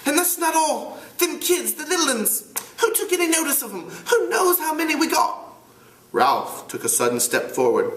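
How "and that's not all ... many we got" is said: put-on high, fussy boy's voice; Piggy's voice. Piggy scolding the older boys in a high voice. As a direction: pitch up into an indignant schoolboy. Piggy frets about the littluns.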